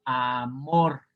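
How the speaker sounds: amor with the stress on MOR, drawn out; stress on last syllable. Shown as a demonstration. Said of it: "la sílaba tónica en la última".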